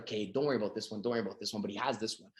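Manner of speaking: hushed, talking herself into it; quiet. Retold or imitated. Woman giving up her criteria.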